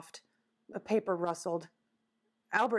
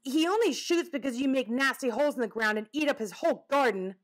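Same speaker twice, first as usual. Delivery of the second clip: fast, higher-pitched accusation; accusing; 5 semitones higher, 3 dB louder, faster than usual. Acted. Albert accusing the woodchuck over the garden, indignant. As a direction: rattle it off as an indignant charge. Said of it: "Albert accused".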